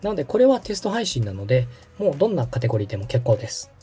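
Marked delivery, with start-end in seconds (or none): none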